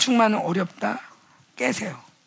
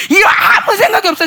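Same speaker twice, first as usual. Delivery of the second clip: crying shout; 8 semitones higher, 7 dB louder than usual. Real, not acted. Preacher cries out at peak.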